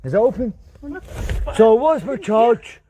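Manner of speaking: usual speaking voice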